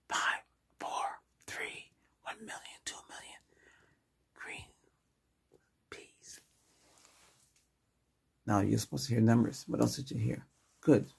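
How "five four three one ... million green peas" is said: soft whispered word list for a hearing test. Shown as a demonstration. Doctor whispers fruit names to test the patient's hearing. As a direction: breathe each word with no voice. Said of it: "can you tell me you hear this".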